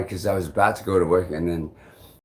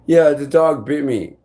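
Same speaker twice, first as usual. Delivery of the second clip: raised, louder retold complaint; imitated accusation; 5 semitones higher, 5 dB louder than usual. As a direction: voice the accuser, insistent. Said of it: "he's all like".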